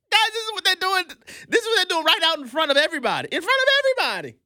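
high-pitched, half-laughing exasperation; exasperated outburst. Real, not acted. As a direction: rant in amazed disbelief.

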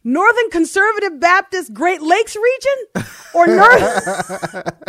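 high, loud, slow, breaking into laughter; fighting the giggles mid-list; laughing through the line. A narrator's reading. Voicing second man, laughing.

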